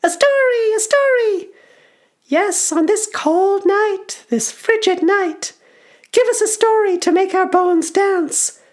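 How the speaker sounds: put-on very high, loud goblin child; excited goblin. Goblin child begging for a tale by night, excited squeal. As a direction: squeal for a story with relish. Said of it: "says one of the goblins excitedly".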